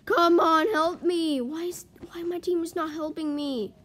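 loud, high, frustrated call; exasperated plea for help. Real, not acted. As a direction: call out to useless teammates. Calling absent teammates for help.

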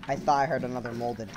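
normal talking voice

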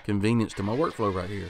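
usual speaking voice

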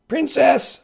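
very high, loud call. Acted. Sicilian calling to Buttercup across the water. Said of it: "the Sicilian called".

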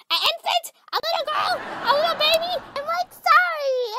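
high, loud string of questions; shrill outrage. Acted. To friend about the babysitting mix-up, high outrage. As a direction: stay way up high, scolding in disbelief.

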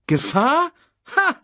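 high, loud scoff; boastful. A narrator's reading. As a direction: scoff cockily at the warning. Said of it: "prahlte Simba".

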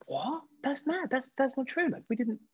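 high, incredulous protest; shocked disbelief. Retold or imitated. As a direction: sound floored by the lie. Stunned denial of a tabloid story.

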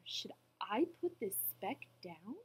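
ordinary delivery, nothing marked

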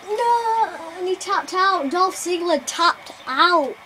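high, loud slow outcry; loud dismay. Real, not acted. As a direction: cry out at the loss. Reacting to the tap-out.

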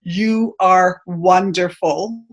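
ordinary talk

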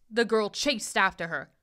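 plain reading voice

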